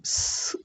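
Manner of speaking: soft voiceless hiss of स with no vowel; isolated s sound. Shown as a demonstration. Teacher isolating the first sound, soft bare स hiss. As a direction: let only air out for स. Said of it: "इसको स जैसा ही बोलना है".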